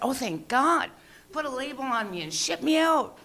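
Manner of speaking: high, gushing relief; mock relief. Comic relieved at getting a label, high mock relief. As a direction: exhale it as a hammed-up thank God.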